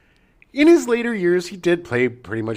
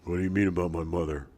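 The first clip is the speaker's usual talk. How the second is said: fast Blade Runner replicant line, quick and clipped; Leon Kowalski impression; 5 semitones lower, 6 dB quieter, faster than usual. Cohost imitating a famous movie line, fast and tense. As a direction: snap it out like a cornered replicant. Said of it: "That was really good".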